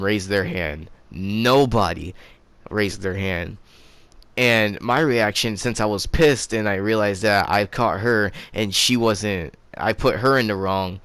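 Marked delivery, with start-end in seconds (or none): none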